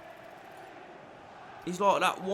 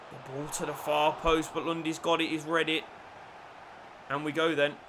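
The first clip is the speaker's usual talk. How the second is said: normal talking voice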